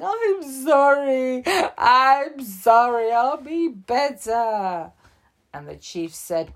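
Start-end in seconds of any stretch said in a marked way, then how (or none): 0.0-4.9 mock crying. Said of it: "started crying and saying".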